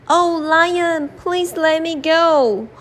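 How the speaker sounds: a little mouse begging the lion to let him go; pleading mouse. Acted. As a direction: beg like a tiny mouse.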